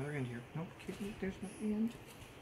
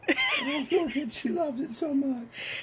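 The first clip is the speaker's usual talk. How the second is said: slow, laughing through words; amused squeal then giggly words; 4 semitones higher, 5 dB louder, much slower than usual. Real, not acted. Laughing at costumed cat.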